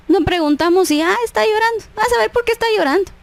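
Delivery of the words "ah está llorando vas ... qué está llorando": high, quick mock gossiping; dismissive gossip. Friend speculating instead of asking why she cries, dismissive. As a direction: toss it off high and fast, uncaring.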